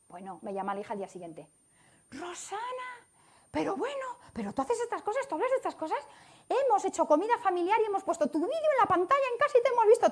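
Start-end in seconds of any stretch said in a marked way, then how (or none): 2.1-10.1 high, amazed burst of news; excited daughter. Retold or imitated. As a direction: retell the daughter's excited call, pitched way up.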